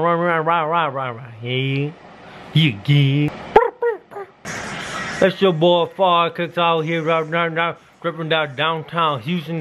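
talking through metal grill; wearing gold teeth grill. Real, not acted. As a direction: teeth covered in gold caps. Seen 3: Gold grill covers upper and lower teeth while speaking throughout.

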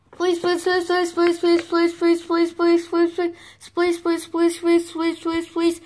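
high, monotone stream of pleading; begging child. Acted. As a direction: pester mommy like a nagging little one. Chicks begging mommy to play.

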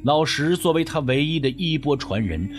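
ordinary delivery

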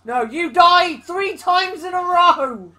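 loud, slow, indignant protest; indignant shout. Real, not acted. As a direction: shout it back in disbelief. Gaming couple bickering over deaths.

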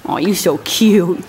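low, slow cooing. Real, not acted. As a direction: coo at the horse. Cooing at a horse.

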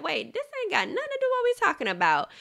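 high, fast, incredulous pushback; imitating herself cutting off an attack out of the blue; retold protest. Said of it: "I'm just like".

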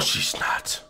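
unvoiced hushed whisper; whispering while hiding. Real, not acted.

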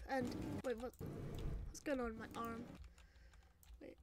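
low, soft puzzled question; quiet bewilderment. Acted. Noticing something wrong with arm, quietly bewildered. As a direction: ask it under the voice, confused. First, muted reaction to arm.

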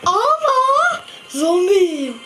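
slow dreamy laughing mumble; sleep talking. Real, not acted. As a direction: drag the words out asleep. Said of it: "domuz taklidi yapıyor".

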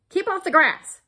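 high, very loud order; cross cactus hollering; grumpy shout. A narrator's reading. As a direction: shout a warning at a trespasser. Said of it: "shouted Hank".

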